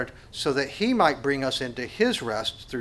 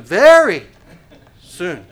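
mock stalling; 4 semitones higher, 15 dB louder, slower than usual. Procrastinator stalling.

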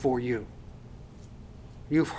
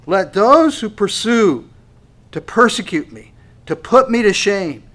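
preaching at full force; 6 semitones higher, 9 dB louder than usual. Acted. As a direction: thunder the verse out.

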